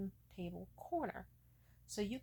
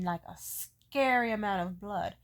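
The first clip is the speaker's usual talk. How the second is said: ordinary talk, nothing marked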